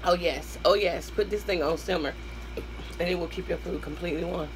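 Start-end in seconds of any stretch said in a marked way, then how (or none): none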